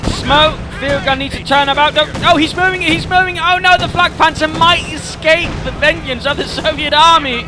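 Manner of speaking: loud, rising excitement; excited shouting. Real, not acted. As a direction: yell it as the chase peaks. Caster watching tank chase climax.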